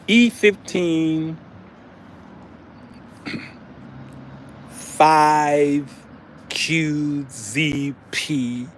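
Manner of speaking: very slow, spelled out letter by letter; slow spelled address. Retold or imitated. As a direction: drag out the strange address. Reading odd address slowly.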